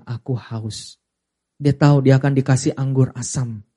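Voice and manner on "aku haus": soft, faint "Aku haus"; a dying man's weak plea; weak thirst. Acted. Jesus on the cross speaking, faint and weak. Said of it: "dia berkata apa?".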